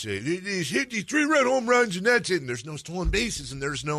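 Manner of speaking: put-on high, loud cranky old man voice; Joe Pittman old man voice. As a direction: complain like an old-timer. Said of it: "I channel my Joe Pittman old man voice".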